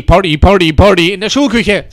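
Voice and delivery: ordinary delivery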